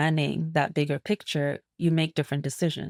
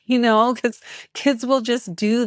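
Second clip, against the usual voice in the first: laughing; 7 semitones higher, 6 dB louder than usual. Real, not acted. Laughs while describing twirling.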